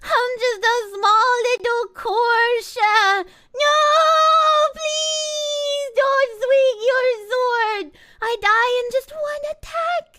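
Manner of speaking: high, slow whimpering plea; mock crying critter; pleading little monster. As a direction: beg the hero for mercy. Begging as a weak monster.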